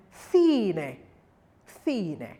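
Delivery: cine begun with a soft TH, "thee-neh"; soft Spanish C. Shown as a demonstration. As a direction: draw out cine with a TH onset. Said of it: "an E, it's soft".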